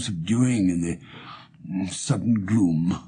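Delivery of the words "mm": ordinary delivery, nothing marked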